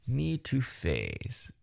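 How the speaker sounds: soft, very slow taunt; hushed, drawn-out teasing imitation. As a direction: run it together quietly and slowly. Repeats the taunt, softly.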